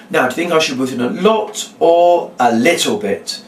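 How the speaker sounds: "a little bit" set apart and emphasised at the end; leading question landing heavily on "little bit"; stress on LITTLE BIT. Shown as a demonstration. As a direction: lean hard on "little bit". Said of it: "you emphasise the word little bit".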